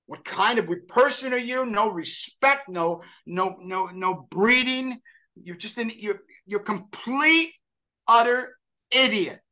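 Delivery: high, slow scolding of himself; self-scolding. Retold or imitated. Dejected young man scolding himself, high and slow. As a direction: berate yourself like a stern elder, drawn out. Berating himself after the blunder.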